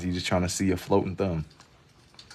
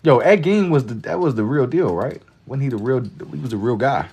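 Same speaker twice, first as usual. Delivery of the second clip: higher, louder realization; excited; 6 semitones higher, 6 dB louder than usual. Real, not acted. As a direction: blurt it out excitedly. Sudden realization.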